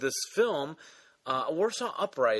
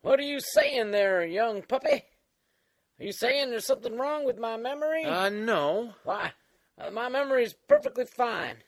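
put-on creaky old-timer voice; Old Man Dan; 7 semitones higher than usual. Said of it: "All right, Old Man Dan".

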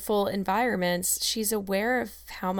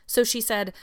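ordinary delivery, nothing marked

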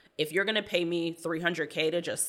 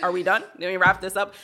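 impatient mock; 3 semitones higher, 7 dB louder than usual. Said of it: "I'm like".

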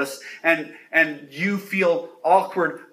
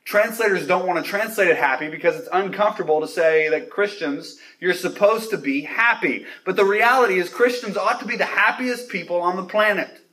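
ordinary delivery

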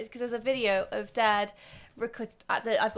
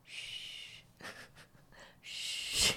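unvoiced, soft shush; child shushing; 11 dB quieter than usual. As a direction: hush the grown-ups. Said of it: "videos of me just going".

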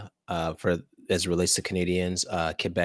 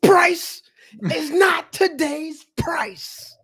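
much higher, slow, hammed-up rapper quote; [name] impression; 8 dB louder, much slower than usual. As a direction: drag it out like a rap punchline. Quoting [name]'s famous line.

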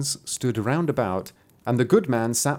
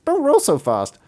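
hen voice; 11 semitones higher, 5 dB louder than usual. Acted. Hen speaking.

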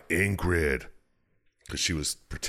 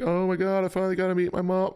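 much higher, fast, gushing mock joy; hammed-up dying joy at finally meeting her mom; dying daughter; faster than usual. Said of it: "being like".

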